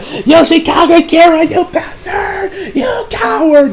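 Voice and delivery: high, drawn-out anguished yell; mock screaming. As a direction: act out a hammy, high-pitched scream of accusation. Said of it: "he's screaming to him".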